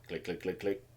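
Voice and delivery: very soft, low, evenly spaced clicking; imitated machine clicks. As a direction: mimic a dial clicking under your breath.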